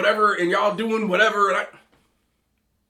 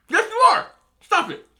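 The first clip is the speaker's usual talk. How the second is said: much higher, louder insistence; emphatic contradiction; 12 semitones higher, 4 dB louder than usual. Acted. Arguing with people who claim they're different, high-pitched insistence. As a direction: pitch it way up, insisting over their denial.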